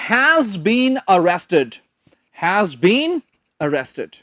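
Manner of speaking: very slow, word by word for writing; slow dictation. Shown as a demonstration. Teacher dictating an example sentence, very slowly. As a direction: dictate it slowly so they can write. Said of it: "पहले sentence लिख ले".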